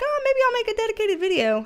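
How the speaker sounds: high, fast mock of herself; chirpy self-quote. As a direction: mimic her own past thought. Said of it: "This is why I'm like".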